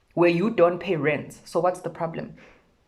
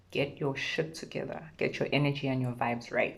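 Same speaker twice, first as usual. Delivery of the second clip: much softer, weary repeat; a tired, quiet "Get your shit together"; 2 semitones lower, 7 dB quieter than usual. Real, not acted. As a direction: let the repeat sink down quietly.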